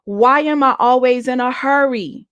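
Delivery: mock the desperate shout of a prayer; lightly relived cry out to God; retold scream to God. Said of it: "And I just screamed out".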